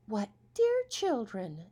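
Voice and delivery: slow, high, loving stepmother voice; tender stepmother. Acted. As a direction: coo over the children like a new mother. Stepmother greets new children.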